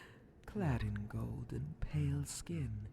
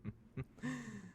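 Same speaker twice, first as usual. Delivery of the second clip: mocking evil laugh; 10 semitones higher, 4 dB quieter than usual. Demon mocks naive plan.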